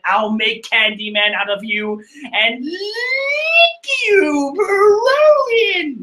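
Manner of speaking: slow, drawn-out mock villain taunt; hammed-up villain. Perospero threatening Brook, a slow hammed-up villain taunt. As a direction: stretch it out like a cartoon villain.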